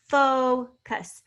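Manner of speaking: focus pulled apart as "fo", pause, "cus"; teacher splitting focus into two beats; syllable by syllable. Shown as a demonstration. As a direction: sound out focus with a long O, then "cus". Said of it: "Fo is a long O sound".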